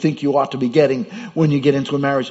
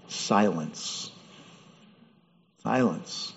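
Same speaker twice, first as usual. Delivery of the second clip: hushed silence; 6 semitones lower, 10 dB quieter, much slower than usual. Acted. Dramatic pause in sermon.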